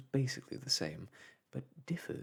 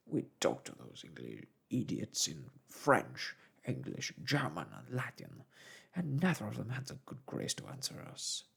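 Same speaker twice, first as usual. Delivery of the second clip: lower, gruff-voiced angry sailor; irate harpooner; 2 semitones lower than usual. A narrator's reading. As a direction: grumble in rising indignation. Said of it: "said our irascible companion".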